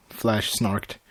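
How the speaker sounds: usual speaking voice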